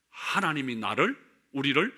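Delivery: ordinary talk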